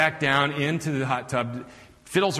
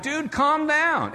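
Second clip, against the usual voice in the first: high, slow Arkansas drawl; Arkansas twang; 13 semitones higher, much slower than usual. Retold or imitated. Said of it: "he's got this twang".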